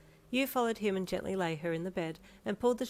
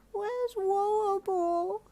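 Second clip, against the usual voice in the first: put-on high, slow, pleading little girl voice; a toddler growing worried; urgent toddler; 4 dB louder, much slower than usual. A narrator's reading. Toddler urgently asking her parents for her ball, high and anxious. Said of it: "Koemi asked urgently".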